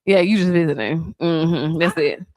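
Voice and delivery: ordinary talk, nothing marked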